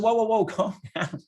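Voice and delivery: high-pitched, laughing "whoa, calm down"; mock alarmed calming. Retelling himself calming the quarrel.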